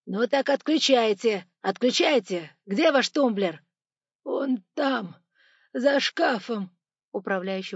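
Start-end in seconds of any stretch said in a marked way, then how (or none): 0.1-3.6 loud, impatient orders; exasperated loud demand; urgent shouting. A narrator's reading. Robber urges the sobbing man. 4.2-6.7 high tearful wail; weepy, high-pitched cry; sobbing. A narrator's reading. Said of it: "уже вовсю рыдал начальник кассы".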